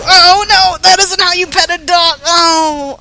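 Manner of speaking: loud, high dismayed yelling; alarmed outcry. Pretend. Player sees the dog hit again, loud dismay. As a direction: cry out in horrified protest.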